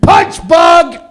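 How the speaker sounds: high loud shout of the game call. Shown as a demonstration. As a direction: yell it as you punch. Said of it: "and go".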